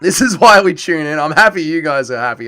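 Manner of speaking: ordinary talk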